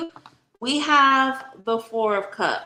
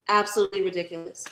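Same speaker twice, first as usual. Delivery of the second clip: ordinary talk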